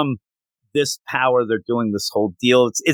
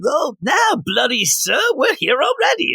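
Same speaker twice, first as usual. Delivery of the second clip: mock British redcoat; 14 semitones higher than usual. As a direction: shout it back like a smug redcoat. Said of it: "I loved their British accents".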